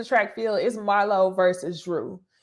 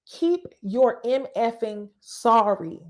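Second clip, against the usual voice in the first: drawn out word by word; slow emphatic repeat; slower than usual. Retold or imitated. Host repeats castmate's clapback to viewers, slow and deliberate. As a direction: land each word slowly and deliberately.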